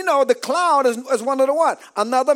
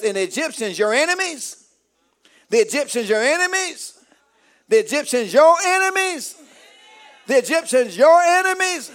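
building preaching; 4 semitones higher than usual. Acted. Preacher declaring victory over enemies, building full-force preaching. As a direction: build the refrain to a preacher's shout.

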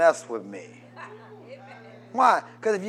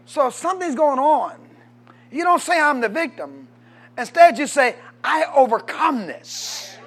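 much higher and louder, building to a shout; preaching at full force; 7 semitones higher, 9 dB louder than usual. Real, not acted. Preacher declaring victory to the crowd, shouting. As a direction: whip the congregation up to a roar.

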